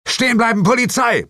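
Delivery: high-pitched, forceful shout; roared command. A narrator's reading. Detective orders fleeing hare to halt, roaring. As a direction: bellow it after a fleeing suspect. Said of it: "brüllte ich".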